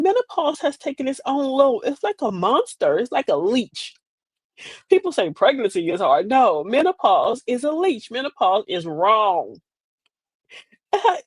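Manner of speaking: normal talking voice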